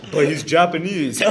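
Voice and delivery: high, loud naive mom voice; imitating mom. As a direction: play mom as if it were obvious.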